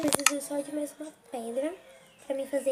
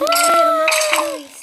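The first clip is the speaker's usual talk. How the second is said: high panicked scream; scared shriek; 13 semitones higher, 11 dB louder than usual. Real, not acted. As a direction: shriek it in sudden fright. Startled by sudden creeper.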